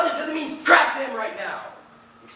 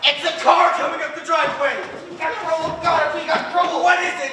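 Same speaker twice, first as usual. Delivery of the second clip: louder, higher panicked warning; alarmed; 5 semitones higher, 5 dB louder than usual. Acted. The host warns his friends that a car is coming up the drive in a loud panic. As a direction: sound the alarm, rising panic.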